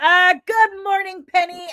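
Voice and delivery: high, loud sing-song hello; cheery shout-out to a chat viewer; big shouted greeting. Real, not acted. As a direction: belt the good morning across the room.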